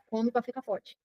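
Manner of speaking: soft, distant quoted advice; quoting a grown-up's advice. Retold or imitated. A childhood adult telling a child, softly quoted. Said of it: "alguém falou pra ela".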